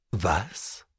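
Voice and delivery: low, soft startled question; a hushed, baffled what; quiet surprise. A narrator's reading. Narrator's inner surprise.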